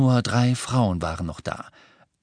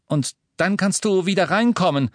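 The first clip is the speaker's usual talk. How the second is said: calling after her; 10 semitones higher, 3 dB louder than usual. A narrator's reading. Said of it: "rief er ihr nach".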